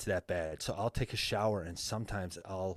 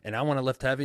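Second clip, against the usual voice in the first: ordinary talk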